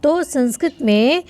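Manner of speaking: ordinary delivery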